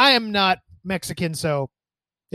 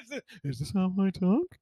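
softer mock American accent; 9 dB quieter than usual. Host wonders how he talks, in a mock American accent. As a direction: try on an exaggerated American accent, softly. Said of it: "what's my American accent?".

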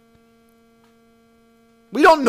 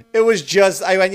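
normal talking voice

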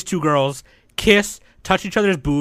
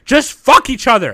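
exasperated shout; 10 semitones higher, 9 dB louder than usual. Real, not acted. Exasperated outburst at co-hosts.

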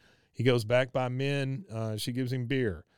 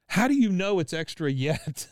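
louder, higher indignant jab; mock indignant; 6 semitones higher, 6 dB louder than usual. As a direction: needle him about the extra bucket. Bobby snaps at Hank.

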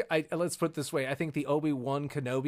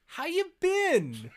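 very high, stretched-out comic question; awkward small talk; 14 semitones higher, much slower than usual. Acted. Maul sweet-talking his master, awkwardly casual. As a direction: squeak out a hammed-up casual catch-up.

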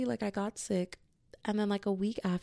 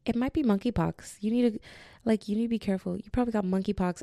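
higher, louder imitation of a worried friend; urgent warning; 4 semitones higher, 4 dB louder, faster than usual. Friend warning her about the symptoms, acted urgent insistence.

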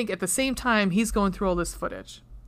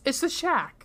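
usual speaking voice

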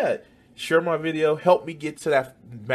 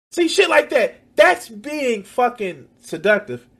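excited high exclamation; 10 semitones higher, 9 dB louder than usual. Real, not acted. Excited commentary.

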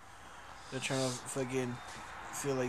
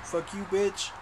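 rising, louder mock insult; jokey comeback to a stranger, getting higher and louder; 5 semitones higher, 5 dB louder, slower than usual. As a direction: snap back at a heckler in a hammed-up voice.

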